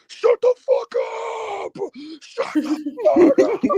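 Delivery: high, slow stretched mock yell; high drawn-out shout; yelling fat guy. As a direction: imitate the angry fan screaming, ending in a laugh. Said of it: "and he's like".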